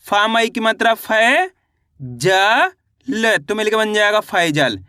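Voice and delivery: slow, syllable by syllable, ending on Faizal; slow spelling out. Shown as a demonstration. Teacher spelling a word out slowly for children. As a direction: spell फा माई की मात्रा फै slowly, then join into Faizal. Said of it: "चलिए देखिए किस तरीके से इनको पढ़ा जाता है".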